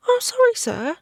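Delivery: put-on high, meek girl's apology; small girl caught out; timid girl. A narrator's reading. Girl character voice.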